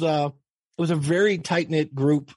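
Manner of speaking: usual speaking voice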